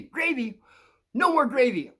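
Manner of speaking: high shouted cartoon yell of gravy; cartoon catchphrase shout. Recalling the cartoon, shouts its catchphrase high.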